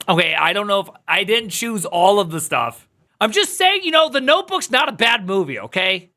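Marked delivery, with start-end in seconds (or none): 3.2-6.1 defensive. Real, not acted. Defending his movie taste.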